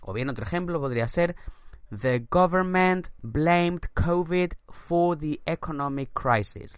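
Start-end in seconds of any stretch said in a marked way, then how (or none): 1.9-6.8 slow, higher English read; slow model sentence. Shown as a demonstration. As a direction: read it out slowly for learners. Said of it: "Repito la frase".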